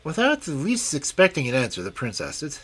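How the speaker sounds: ordinary delivery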